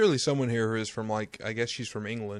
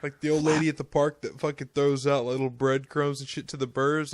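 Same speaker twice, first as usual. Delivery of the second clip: ordinary talk, nothing marked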